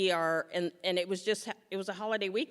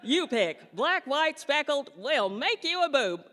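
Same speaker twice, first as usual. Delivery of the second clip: higher, louder mock-sales pitch with laughter; mocking doctor; 6 semitones higher, 4 dB louder than usual. Mocking the surgeon's sheep offer, a high laughing pitch. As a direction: sell the sheep pin like a cheery salesman. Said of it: "You did not just say to me".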